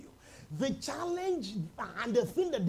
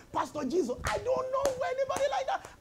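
laughing mock denial; 3 dB louder than usual. Disciple denying, laughing.

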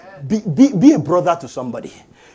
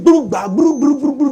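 higher, babbled rumbling noise; imitated commotion; 5 semitones higher than usual. Parent describing the children's noise downstairs, imitated commotion. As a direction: rattle off a mock racket.

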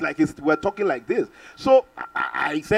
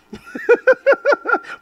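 high laugh; 7 dB louder than usual. Retold or imitated. Laughing while retelling the overseer.

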